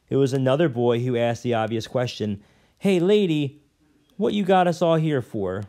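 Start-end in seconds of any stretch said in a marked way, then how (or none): 2.8-5.6 higher, louder cocky urchin; rough street boy. A narrator's reading. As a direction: call out like a tough kid. Tough boy calls out question.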